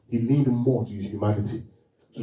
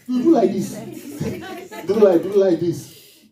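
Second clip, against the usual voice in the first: mocking imitation; 6 semitones higher than usual. Preacher mimics a latecomer mockingly.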